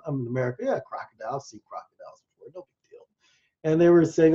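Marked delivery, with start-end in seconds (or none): 0.0-3.1 low, trailing off to barely audible; dismissive. Retold or imitated. Storyteller replaying his unimpressed reaction to crocodile warnings, dismissive. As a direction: brush it off under your breath, quick and low.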